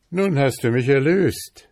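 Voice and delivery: high, loud, joyful declaration; freed prince. A narrator's reading. Prince thanks his rescuer, elated high voice. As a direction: announce it as a released prince.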